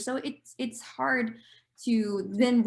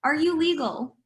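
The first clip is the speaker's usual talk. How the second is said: higher, louder questioning voice; mock a prying interviewer, pitched up; imitated HR interviewer; 6 semitones higher, 5 dB louder than usual. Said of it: "talking to from H R goes".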